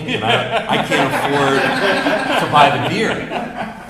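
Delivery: high, loud laughter through the words; laughing. Real, not acted. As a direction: laugh out the punchline, slowly. Laughing through retold thought.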